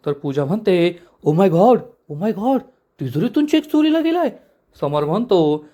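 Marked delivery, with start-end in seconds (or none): 1.3-4.3 high, squealing disbelief on the last line; shocked gasp. A narrator's reading. Pooja hearing the check was stolen from the safe, shocked. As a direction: act stunned, voice jumping up.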